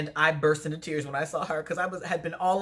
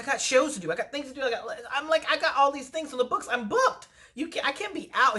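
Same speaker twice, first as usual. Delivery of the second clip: much higher, rushing, pleading; frantic protest; 9 semitones higher than usual. Retold or imitated. To the doctor keeping her off work, frantic high protest. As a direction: panic about all the booked shows.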